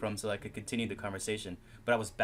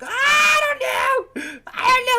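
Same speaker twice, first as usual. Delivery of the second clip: slow, drawn-out high yell of I don't know; panicked shout; 16 dB louder, slower than usual. Acted. Flustered student blurts out answer, shouting.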